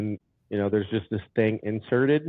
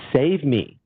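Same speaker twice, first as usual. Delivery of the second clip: louder, higher cry of save me; mock desperate yell; 5 semitones higher, 5 dB louder than usual. Retelling the demon attack, calling out for help loudly. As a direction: yell it as if pinned down. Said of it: "I'm yelling in my head".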